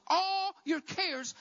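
"all" high and greatly drawn out; stress on ALL. Retold or imitated. Preacher voicing God's invitation, "all" high and stretched. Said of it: "I really want to emphasize that word".

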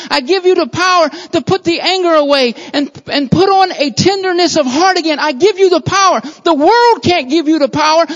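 loud, high, driving preacher's cry; full-force preaching. Acted. Preacher urging the church to take up love, loud and soaring. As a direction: preach it out hard to the congregation.

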